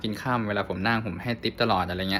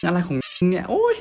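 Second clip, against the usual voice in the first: higher, incredulous retort; exasperated disbelief; 8 semitones higher than usual. Retold or imitated. Passenger arguing back at the driver, higher exasperated voice. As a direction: throw up your hands in disbelief. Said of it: "กูแบบ".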